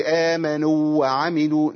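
آمنوا وعملوا said with the واوين merged; wrong merged reading. Shown as a demonstration. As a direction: clip the madd of آمنوا into وعملوا. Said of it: "ما أقولش".

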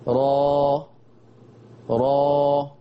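را with the jaw wide open; full open ra. Shown as a demonstration. Teacher showing the fully open vowel. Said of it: "فتحة كاملة".

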